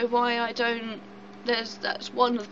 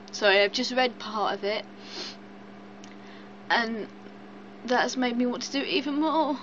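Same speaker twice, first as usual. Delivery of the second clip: ordinary delivery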